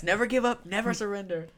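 mock-heroic Galaxy Quest line; hammy movie hero. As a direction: proclaim it like a hammy sci-fi hero. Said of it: "Galaxy Quest".

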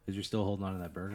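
usual speaking voice

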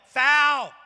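full-throated yell from the stands; dad yelling. Retold or imitated. Dad yells at the referee from the front row, loud yell. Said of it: "he would yell it".

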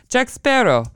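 high-pitched imitated Italian accent. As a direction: say it like an Italian policeman. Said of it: "with the Italian accent says".

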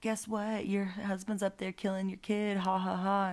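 high, sing-song mocking voice; taunting Satan. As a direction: taunt her with a fake ha-ha-ha. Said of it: "he goes as an old man".

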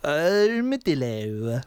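low, slow comic drawl; funny voice. Shown as a demonstration. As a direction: drag it out slowly in a silly low voice. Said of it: "Everyone say armadillo in a funny voice".

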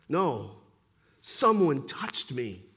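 low, firm and slow; insistent Jesus. Acted. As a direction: calmly hold your ground. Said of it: "Jesus insists".

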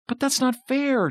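put-on high-pitched childish whine; whining. A narrator's reading. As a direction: protest like a kid who feels cheated. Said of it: "Jonathan whined".

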